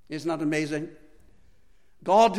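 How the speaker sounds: usual speaking voice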